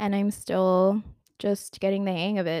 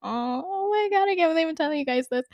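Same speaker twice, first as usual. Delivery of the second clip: laughing squeal; 8 semitones higher, faster than usual. Real, not acted. Embarrassed at her own confession.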